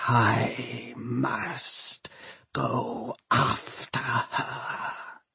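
slow, soft undead machine; eerie whispered resolve, dragged out; horrible voice. A narrator's reading. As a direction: breathe it out word by word. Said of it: "It is a horrible voice".

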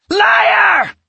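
very high, loud scream. A narrator's reading. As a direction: shriek it in fury. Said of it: "Natasha screamed".

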